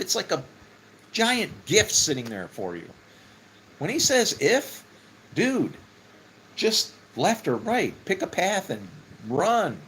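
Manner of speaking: high, loud, urgent preaching voice; excited preacher urging the listeners to grab hold; fiery preaching. Acted. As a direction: preach it out like good news you can't contain. Passionate preaching on God's promises.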